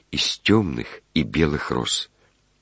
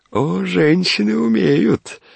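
very high, slow croon; swooning high-pitched relish; gushing admiration; slower than usual. A narrator's reading. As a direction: coo it knowingly, stretched out. Smug daydream about women.

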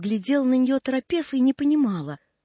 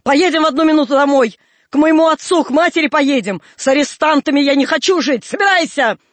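loud, higher, raging orders; angry commanding shout; 4 semitones higher, 7 dB louder than usual. A narrator's reading. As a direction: order him to pack, furious. Angry wife orders husband home.

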